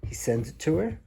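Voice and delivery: ordinary delivery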